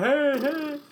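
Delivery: high, loud call. Real, not acted. Host calling out to his cohost, high and loud. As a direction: shout it to grab attention.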